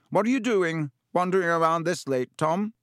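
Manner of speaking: a curious headmaster's question; older wizard voice. A narrator's reading. Dumbledore questions Riddle.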